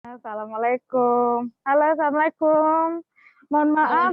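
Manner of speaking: muffled behind a mask; through a face mask. Real, not acted. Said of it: "saya harus pakai masker".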